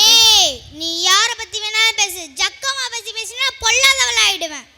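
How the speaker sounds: loud burst, then forceful warning; angry warning. Acted. Devotee mother warns a man mocking Jakkamma, angry warning. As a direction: warn off someone who mocks your goddess.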